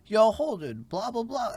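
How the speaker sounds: high, sing-song mock quote of the friend; mock friend reply. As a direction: toss off the reply in a high silly voice. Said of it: "Be like".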